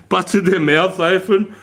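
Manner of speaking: slow, laughing, high. Real, not acted. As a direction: laugh through it. Laughing while telling.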